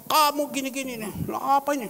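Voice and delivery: high, loud, indignant telling-off; acted scolding. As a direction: imitate an angry boss berating staff. Acting out the boss scolding workers.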